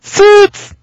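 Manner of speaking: high, loud sudden exclamation; excited shout. Real, not acted. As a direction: blurt it out with a burst. Pitching a gag word excitedly.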